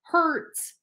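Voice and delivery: put-on young walrus whimpering that his tusk hurts; whiny little walrus. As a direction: whine it like a hurt child. Wilbur complains again.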